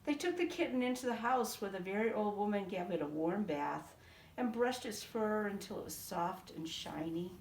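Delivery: ordinary delivery, nothing marked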